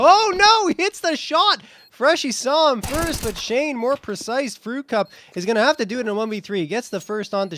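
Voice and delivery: high, loud surprised call; excited shout. Real, not acted. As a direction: yell it like a shock play. Caster reacts to surprise kill.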